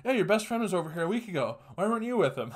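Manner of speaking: quick, high dog impression; talking dog. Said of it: "looking at me and going".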